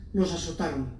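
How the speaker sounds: ordinary talk, nothing marked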